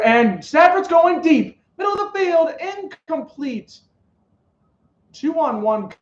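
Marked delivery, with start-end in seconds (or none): 0.0-3.8 excited play-by-play. Real, not acted. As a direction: call the long pass as it flies, pitch climbing high. Calling a deep pass live.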